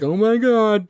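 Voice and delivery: high, loud mock gasp of panic; panicky freak-out. Podcaster mocking a panicked listener, mock panic. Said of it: "don't be like".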